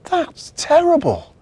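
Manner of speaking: put-on very high, loud child cry; a little boy's shocked cry; dismayed cry. Timmy hears about the lost presents, high dismayed cry.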